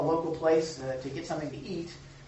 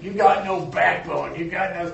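mock insult; 11 dB louder than usual. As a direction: sneer at his weakness. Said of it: "people say just the dumbest things to me".

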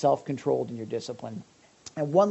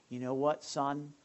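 sincere apology; 8 dB quieter than usual. Shown as a demonstration. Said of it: "then you go and you just say".

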